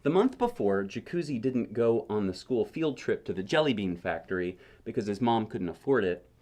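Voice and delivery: plain reading voice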